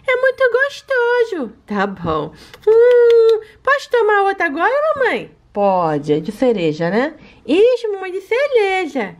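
high, sweet little pet voice begging for treats; baby-voiced pet. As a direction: talk like a greedy cute puppy asking mommy. Alana eats treats.

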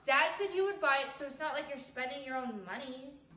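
ordinary talk, nothing marked

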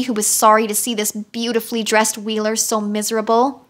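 plain reading voice

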